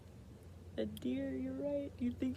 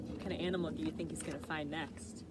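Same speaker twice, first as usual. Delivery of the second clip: usual speaking voice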